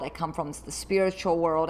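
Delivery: normal talking voice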